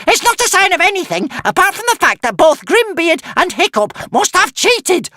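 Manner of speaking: very high, loud protest; squealing witch. A narrator's reading. Witch accuses Hiccup of cheating, squealing. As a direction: squeal in outrage. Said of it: "squealed the witch".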